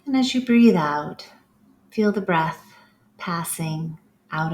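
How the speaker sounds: calm, unhurried meditation guide voice; guided breathing cues. Acted. Yoga teacher guiding the class through breaths, calm meditation voice. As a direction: lead them through each breath with long pauses.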